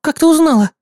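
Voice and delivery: high, shocked question. A narrator's reading. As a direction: gasp it out in shock. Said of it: "с выражением шока".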